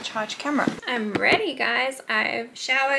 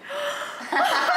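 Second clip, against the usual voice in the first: high gasp that turns into a laugh; shocked gasp. Real, not acted. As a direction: gasp in amused outrage. Sister's slimy noodles remark.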